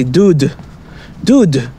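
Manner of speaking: "Dude" with a hard, stopped D; "dude" with a stressed hard D. Shown as a demonstration. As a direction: punch the D sounds of "dude". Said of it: "pronounce the D in the middle really hard".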